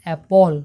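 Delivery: অ্যাপল said loud and clear, English style; pronunciation demo. Shown as a demonstration. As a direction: punch out অ্যাপল as the English word apple. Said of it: "অর্থাৎ যার উচ্চারণ হবে". (জোরে স্পষ্ট করে অ্যাপল বলা)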